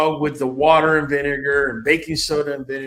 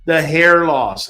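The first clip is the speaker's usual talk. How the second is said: ordinary talk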